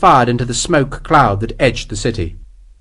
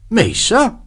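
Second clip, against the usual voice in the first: high-pitched mock innocence; pretended shock; 9 semitones higher than usual. A narrator's reading. Soldier teasing his officer, pretending to be shocked. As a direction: feign shock at the very suggestion. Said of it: "Sharp pretended to be shocked".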